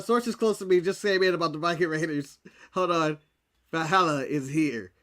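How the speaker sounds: low, hushed breaking news alert; news anchor voice. Pretend. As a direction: announce it like a dramatic bulletin.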